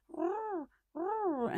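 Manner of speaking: tiny kitten trying to sound fierce; tiny kitten growl. Retold or imitated. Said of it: "she would growl as a little tiny kitten".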